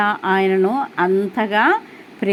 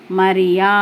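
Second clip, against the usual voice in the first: "Mariya" called lower, lovingly drawn out; Jesus tenderly calling Mary by name, lower and sweet; 4 semitones lower, 3 dB louder than usual. Retold or imitated. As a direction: call "Mariya" as a gentle, loving summons. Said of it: "ఆ పిలుపులో ఎంత మాధుర్యం".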